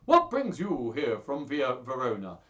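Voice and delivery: put-on loud greeting to an old friend; hearty acted exclamation; exclaimed welcome. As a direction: call out the question heartily. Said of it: "exclaimed Hortensio".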